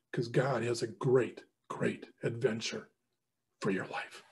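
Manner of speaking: slow, dropping low and soft; hushed earnest appeal. Real, not acted. Speaker closes his appeal to the men, hushed. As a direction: let it sink to a near hush.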